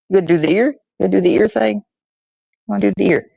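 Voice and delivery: high-pitched pet-talk questions; coaxing a dog. Real, not acted. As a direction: tease the dog into a trick, voice lifted. Coaxing his dog to perform.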